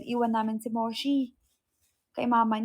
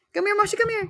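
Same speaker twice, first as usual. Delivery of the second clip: high bright call; coaxing pet call; 9 semitones higher, 4 dB louder, faster than usual. Real, not acted. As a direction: call the dog sweetly. (malambing na pagtawag)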